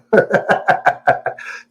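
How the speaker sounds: amused cackle; laugh. Real, not acted. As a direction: break into a run of airy laughter. Laughs at not being taken seriously.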